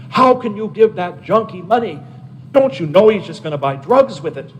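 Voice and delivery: high, indignant scolding of a stranger; angry scolding. Retold or imitated. Stranger scolding the monk for giving money, indignant. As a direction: scold him as if he's a fool.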